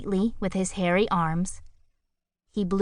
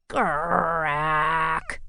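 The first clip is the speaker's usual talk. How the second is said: groaned. A narrator's reading. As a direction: groan it wearily. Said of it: "groaned Grackle".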